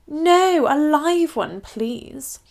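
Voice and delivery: lightly voiced prim old Queen; a hint of a royal lady, matter-of-fact. Queen answering the Duke's question.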